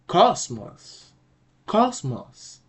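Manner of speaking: "cosmos" with a strong, lengthened first syllable; pronunciation model. Shown as a demonstration. Teacher answering the pronunciation question, "cosmos" stressed on the first syllable. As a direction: say "cosmos" slowly, punching COS. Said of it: "Can you pronounce this?".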